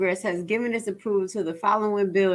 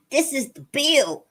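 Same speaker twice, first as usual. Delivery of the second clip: higher, slower emphatic repeat; alarmed disbelief; 5 semitones higher, slower than usual. Real, not acted. Host realizing the real bill is here, alarmed disbelief. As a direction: say it higher and drawn out, aghast.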